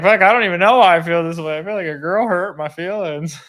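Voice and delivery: mock-wounded kid, high and loud; hurt young son. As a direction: imitate a heartbroken little boy blurting out his confusion. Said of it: "even a six-year-old".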